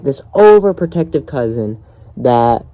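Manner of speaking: usual speaking voice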